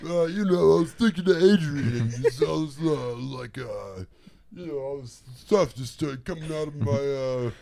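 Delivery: high, loud laughing Rocky voice; Rocky impression. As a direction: play it like a punch-drunk boxer.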